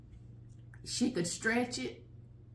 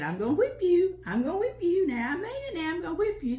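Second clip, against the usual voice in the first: louder, higher scolding mom; mother's mock threat; 6 semitones higher, 5 dB louder than usual. Mother threatening the kids, raised scolding voice. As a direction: wag the finger and threaten, half in fun.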